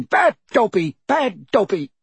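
high, loud self-scolding elf; shouting. A narrator's reading. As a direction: shout in a squeaky, frantic voice.

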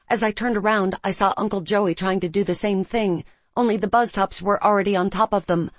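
ordinary delivery, nothing marked

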